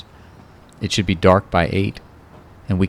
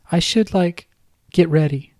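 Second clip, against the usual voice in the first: much higher girlish voice with a hint of a man underneath; a grown man faking a little girl, pitched way up; babyish high voice; 11 semitones higher than usual. Kyle faking Kate's voice on the phone to Ron, high and babyish. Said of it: "his Cuddly Kate voice holding".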